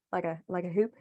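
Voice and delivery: hoop in a light Southern twang. Retold or imitated. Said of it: "depending on where you were in the South".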